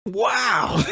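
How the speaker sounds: high, drawn-out wow; amazed. Real, not acted. Host reacting to someone missing the news, amazed. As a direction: exclaim it in real amazement. Reacting to not having seen it.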